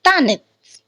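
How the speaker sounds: "tanits" with stress on "ta" and a brief "i"; short glimpse of E. Shown as a demonstration. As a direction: reduce the "ye" to a light "i" in "tanits". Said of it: "We can say short glimpse of E".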